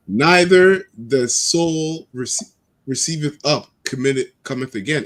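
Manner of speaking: slow, solemn Bible reading; scripture reading. Acted. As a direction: read it like scripture.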